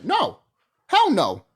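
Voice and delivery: ordinary talk, nothing marked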